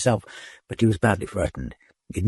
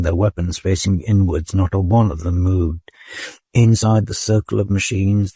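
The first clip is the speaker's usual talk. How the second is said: plain reading voice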